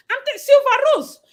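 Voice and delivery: hailing a viewer by name; calling out a name. Real, not acted. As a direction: sing out the name in surprise. Calling out to a commenter.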